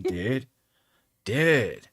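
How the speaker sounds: laid-back surfer dude; Californian dude accent. Shown as a demonstration. Showing her dude accent, a laid-back Californian Dude. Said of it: "my dude accent".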